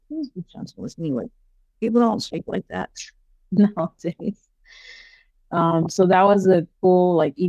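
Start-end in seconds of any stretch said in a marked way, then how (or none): 0.1-1.1 put-on hushed, pleading old-fashioned lady; soft pleading voice. Retelling the ghost's plea, soft and pleading. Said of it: "the way she said it". 1.8-4.5 laughing. Real, not acted. Laughing remark.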